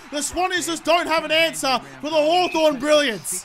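high, loud, slowed goal call like a TV broadcaster; hammed-up sports commentary; mock commentator call. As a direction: announce the goal like a play-by-play caller.

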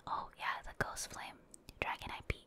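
usual speaking voice

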